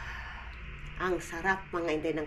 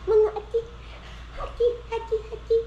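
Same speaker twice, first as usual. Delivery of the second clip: playful squeal; 10 semitones higher, faster than usual. Real, not acted.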